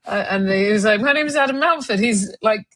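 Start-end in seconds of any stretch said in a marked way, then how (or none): 1.0-2.0 posh caller. Retold or imitated. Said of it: "this guy sounded really posh".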